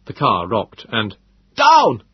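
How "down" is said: sudden loud yell, much higher; shouted warning. A narrator's reading. Moses yelling at them to duck from the falling masonry, loud and sharp. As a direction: bark it out to get everyone down. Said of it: "Moses called".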